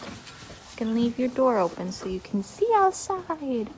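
ordinary talk

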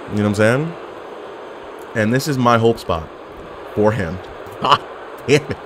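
ordinary talk, nothing marked

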